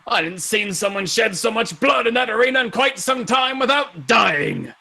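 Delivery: slow, hearty praise; grand veteran honoring a fighter; admiring old champion. Acted. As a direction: stretch the words with booming approval.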